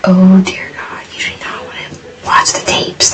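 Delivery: hushed, breathless warning whisper; voiceless whisper, leaning in close. Retold or imitated. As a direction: whisper it like a secret you shouldn't share. Hushed quote of warning friend.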